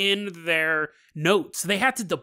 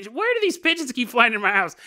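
higher, feigned bafflement; mock puzzled mom; 6 semitones higher, 3 dB louder than usual. Mom covering for her daughter, higher mock puzzlement. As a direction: pretend innocent confusion.

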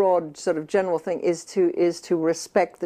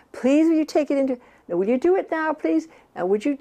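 higher-pitched repeated pleading; nagging; 7 semitones higher than usual. Retold or imitated. Mother nagging a child to move the toy, pitched-up pleading. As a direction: nag over and over, mock-weary. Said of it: "it's called nagging".

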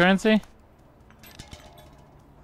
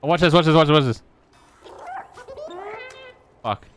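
usual speaking voice

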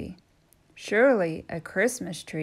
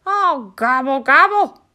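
loud, high moaning gobble; moaning turkey gobble; 6 semitones higher, 12 dB louder than usual. Acted. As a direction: moan in despair. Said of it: "moaned Turkey".

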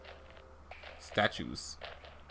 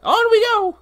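much higher, chirpy cartoon sidekick; bouncy little helper creature voice; 6 dB louder, slower than usual. Sidekick character voice.

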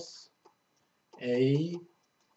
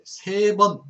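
세 번 said higher and louder, stressed hard; punched 세 번; 7 semitones higher, 9 dB louder, slower than usual. Shown as a demonstration. Teacher stressing the count for students, loud and high. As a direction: hit 세 번 hard so students remember it. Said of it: "자, three times".

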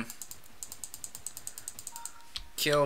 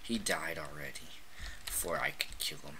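whispered frustration; 12 semitones lower, 15 dB quieter, faster than usual. Real, not acted. Grumbling to himself after losing a kill, whispered. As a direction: hush it out, annoyed.

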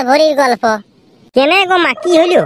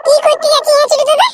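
put-on high, young kid's voice; child's voice; 9 semitones higher, faster than usual. Little kid asking Bhola where they're going, in a high child's voice. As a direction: play a little kid calling after the big boys.

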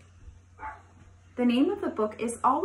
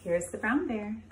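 plain reading voice

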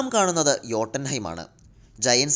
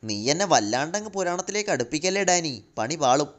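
ordinary delivery, nothing marked

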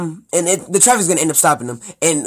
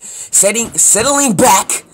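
mock-dramatic call; 8 semitones higher, 7 dB louder, much slower than usual. Overblown delivery of a read line.

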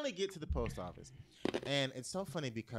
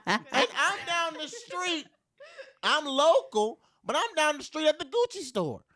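laughing exclaim; 12 semitones higher, 9 dB louder, slower than usual. Retold or imitated. Laughing about being at Gucci.

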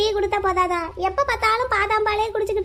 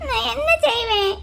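slow, helpless whimper; drawn-out tearful plea; 3 semitones higher than usual. Acted. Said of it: "எதுக்கு அழுதுட்டு இருக்க".

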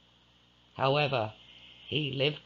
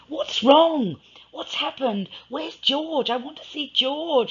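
put-on much higher, anxious young woman's voice; pleading to see George, alarmed; voicing Alice, distressed and higher; frantic Alice; 13 semitones higher, 6 dB louder than usual. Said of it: "she said".

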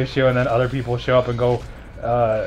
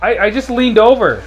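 high, loud protest; indignant; 6 semitones higher, 6 dB louder than usual. Real, not acted. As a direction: protest in disbelief. Protesting after dying.

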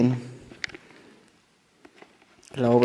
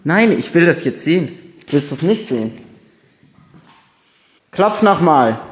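loud, daring call; loud challenge; 2 semitones higher, 9 dB louder than usual. Real, not acted. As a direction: dare the ghost, loudly. Challenging the ghost loudly.

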